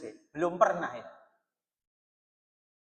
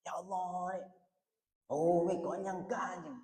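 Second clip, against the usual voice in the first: soft, hushed, awed lament; quietly moved; 2 semitones lower, 8 dB quieter, slower than usual. Shown as a demonstration. Preacher showing how the devout feel the verse, hushed. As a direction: breathe it out as if the ground just shook. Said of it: "Nah, kayak gitu".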